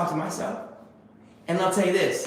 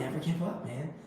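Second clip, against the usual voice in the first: soft inner voice, much softer; 8 dB quieter than usual. Retold or imitated. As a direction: murmur it like a voice at the back of your mind. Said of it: "something telling me".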